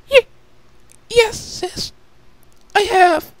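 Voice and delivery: beg, close to tears, tiny voice; frightened little sister. Acted.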